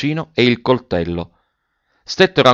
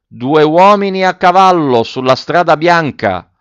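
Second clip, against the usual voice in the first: high, slow drawn-out call; calling through cupped hand; 7 semitones higher, 4 dB louder than usual. A narrator's reading. Boy in the tree reporting to officer, calling out. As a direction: call down from a height, stretching the words. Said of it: "facendosi portavoce della mano".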